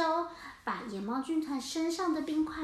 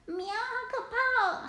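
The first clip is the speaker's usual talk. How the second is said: high, frightened meow; scared cats; 8 semitones higher than usual. Acted. Cats facing the orca, high frightened squeal. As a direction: squeal in fear.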